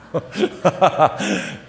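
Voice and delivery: loud laugh at one's own story; laughter. Real, not acted. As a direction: burst out laughing. (크게 터지는 웃음)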